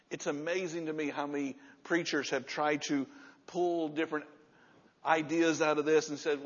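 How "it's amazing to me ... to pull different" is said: ordinary talk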